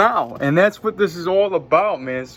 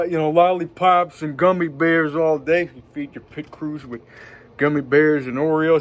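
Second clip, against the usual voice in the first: ordinary talk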